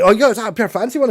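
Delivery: mock excitement over a mate's car; excited quote. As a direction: gush over the fancy car.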